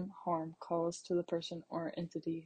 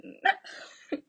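short airless laugh; breathy laugh; 7 dB louder than usual. Real, not acted. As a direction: let a laugh slip out.

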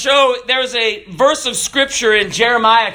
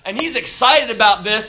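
ordinary delivery

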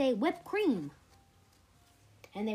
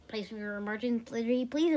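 ordinary delivery, nothing marked

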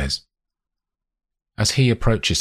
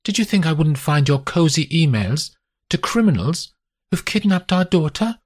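higher, sharp accusing wife's voice; 11 semitones higher than usual. A narrator's reading. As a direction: voice a betrayed mother, pitched up and pointed. Wife voiced confronting husband.

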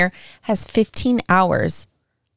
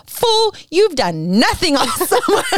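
inner voice scolding; 7 semitones higher than usual. Retold or imitated. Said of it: "the other side of me was like".

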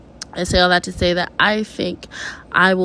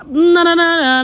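sudden self-correction; 7 semitones higher, 5 dB louder than usual. Real, not acted. Cuts herself off mid-sentence.

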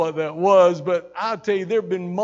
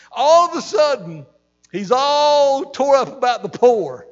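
much higher, louder preacher's cry; fired-up preaching; 4 semitones higher, 6 dB louder than usual. Real, not acted. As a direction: preach it hard to the congregation. Preaching at full force.

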